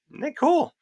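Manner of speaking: mock-gleeful squeal of sarcasm; sarcastic. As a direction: sell it as fake delight, way up high. Mocking the shutdown outcome.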